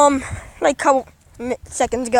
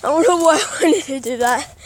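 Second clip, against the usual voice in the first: ordinary delivery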